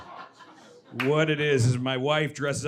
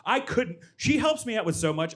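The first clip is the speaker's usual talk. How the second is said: ordinary talk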